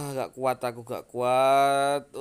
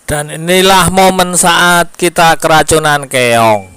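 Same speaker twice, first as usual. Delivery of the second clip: dramatic announcement; 3 semitones higher, 19 dB louder than usual. Acted. Host announces the poisoning moment.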